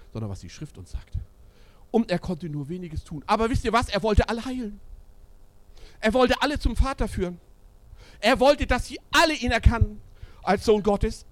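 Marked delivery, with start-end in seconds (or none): 1.9-11.3 climbing from plain talk into loud, high preaching; rising preaching. Acted. Preacher urging the church about Jesus, building into loud preaching. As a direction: build it up to a pulpit cry.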